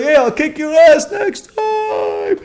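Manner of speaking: high, slow taunting tough-guy voice; mock a coward's empty threat. Mocking cowards who run away, high taunting voice.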